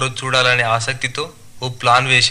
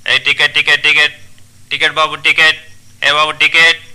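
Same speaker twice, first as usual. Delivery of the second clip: rapid-repeat ticket call; bus conductor calling; 2 semitones higher, 4 dB louder than usual. Acted. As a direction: chant it out to the passengers. (టికెట్లు అడిగే conductor పిలుపు)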